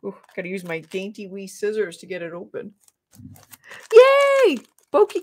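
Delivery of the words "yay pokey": much higher and louder yay; gleeful loud whoop; excited cheer. Real, not acted. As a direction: cheer it out loud and high for a friend arriving. Cheering a viewer's arrival.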